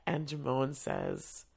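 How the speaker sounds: normal talking voice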